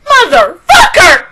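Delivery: high, loud shout of outrage; outraged shout. Real, not acted. Viewer cursing an episode's cliffhanger, outraged shout. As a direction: scream the curse at the screen.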